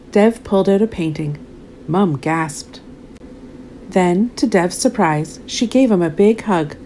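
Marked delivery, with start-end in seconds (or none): none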